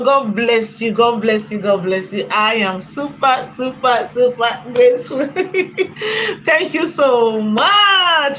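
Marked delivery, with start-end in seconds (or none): 6.4-8.4 high, slow, stretched thanks; excited thanks. Real, not acted. Thanking donor.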